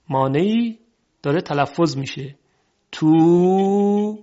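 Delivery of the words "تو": "تو" with the oo sustained, rising and louder; long u vowel. Shown as a demonstration. Teacher isolating the vowel, "تو" drawn out long. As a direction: stretch the oo in "تو". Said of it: "صدای او".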